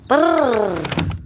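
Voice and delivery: loud high shivering brrr. Real, not acted. Shivering in cold.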